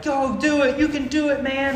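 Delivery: put-on high, loud cheering kid voices. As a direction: cheer him on from the line. Kids in line cheering him.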